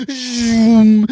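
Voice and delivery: high, drawn-out lightsaber hum; imitated lightsaber noise. Comic mimics Star Wars movies for listeners, imitated lightsaber noise. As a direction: make lightsaber whooshes with your mouth.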